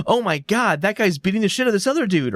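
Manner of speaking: high, excited imitation of a gasping onlooker; mock bystander alarm. Retelling the NPC who saw the beating, high mock alarm. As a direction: play a shocked townsperson. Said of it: "this one N P C goes".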